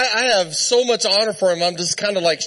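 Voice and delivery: usual speaking voice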